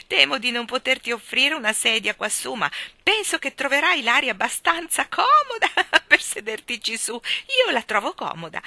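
put-on a giggly, gallant old host; laughing host. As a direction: Mr Wigg apologising to Jane, laughing. Wigg chatting amid laughs.

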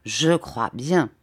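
slow, low, sly assurance; a shrewd, unhurried affirmation; knowing. A narrator's reading. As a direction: draw it out low, as if in the know. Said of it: "d'un air fin, comme pour dire".

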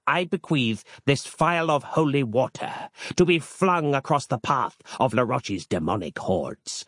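pompous holy man offering a relic; a hint of a grave medieval brother; archaic monk Harland. A narrator's reading. Harland the old monk speaks.

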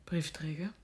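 a stunned, voiceless echo of the word; incredulous whisper. Acted. Mother whispering back the job of her daughter's suitor, incredulous.

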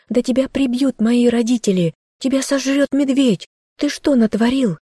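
high, upset yelling at him; girl pulling free, shouting at the boy. Acted. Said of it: "Вырывалась и кричала на него девушка".

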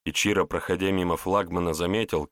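ordinary delivery, nothing marked

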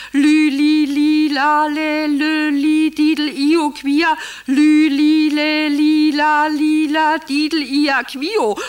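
loud, high chanted syllables on one note; excited birdsong code. Acted. Wife rehearsing her muddled phone code, loud birdsong chant. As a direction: sing out the bird code insistently. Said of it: "muss in der Aufregung".

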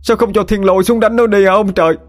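very high, hammed-up lament to the heavens; theatrical keening cry; mock wailing scream. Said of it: "hai tay giơ lên trời mà gào thét".

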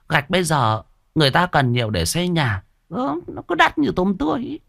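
put-on high-pitched nagging old shopkeeper; old woman voice. Old shop owner nagging the debtor, high old woman voice. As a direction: needle him about his debt like a sharp old woman.

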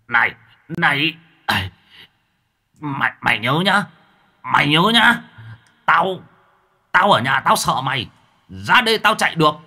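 high, loud demon bluster, rising to a shriek; cowardly demon threats. Acted. Demon yelling it will rip out Mặc's heart, shrill bluster. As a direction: shout empty threats while running away.

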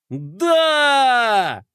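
high, loud victory cheer; cheering shout. A narrator's reading. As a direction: shout it in triumph. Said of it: "хором закричали геройчики".

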